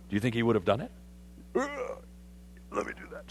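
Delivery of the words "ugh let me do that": a scornful groan, then a hushed, unvoiced "let me do that"; scoffing Naaman. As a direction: scoff as the proud general. Naaman scoffing, voiced by preacher.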